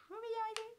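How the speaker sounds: very high, squeaky little voice; squeaky mock struggle. Vlogger fumbling with one hand, in a playful squeaky voice.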